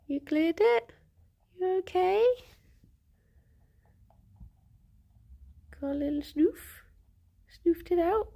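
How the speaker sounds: high, cooing questions to a pet; cooing pet talk. Real, not acted. Streamer cooing over her pet after its sneeze, high and tender.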